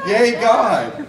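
laughing exclamation of praise; joyful cheer. Real, not acted. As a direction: shout it with delight. Celebrating the story's punchline.

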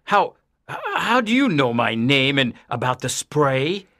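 nervous stammer on the first word. A narrator's reading. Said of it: "asked Mister Kent nervously".

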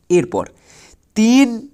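ordinary delivery

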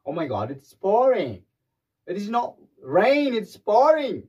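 high, loud, slow amazement at the rain; mock amazed. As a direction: gasp it out like the rain is pouring down. Acting out surprise at heavy rain.

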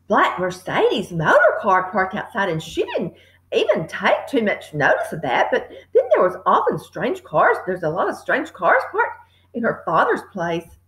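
usual speaking voice

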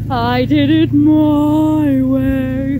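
I did it my way, very slow and loud; defiant drawn-out. Real, not acted. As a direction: dig in, proud and stubborn. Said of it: "But you know what?".

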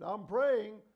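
annoyed shout at the door; retold irritated call. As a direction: shout it at the knocking wife. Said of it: "and I said".

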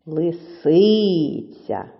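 "Лисиця" with a very long СИ; slow "Лисиця", leaning on the stressed си; stress on second syllable. Shown as a demonstration. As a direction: stretch the middle of "Лисиця": ли-СИИИ-ця. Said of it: "який же склад наголошений".

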